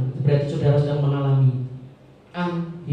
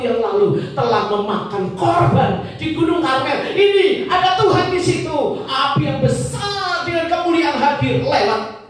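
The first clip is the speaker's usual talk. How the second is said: excited Elijah; 11 semitones higher than usual. Acted. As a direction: shout it like you finally found God. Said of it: "lalu Elia begini".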